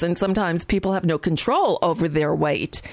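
ordinary talk

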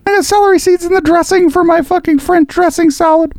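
shrill high indignant rant; high mock outrage. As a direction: squeal it out in mock fury.

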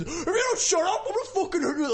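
high mock threat; angry shout. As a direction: yell like a red-faced kid.

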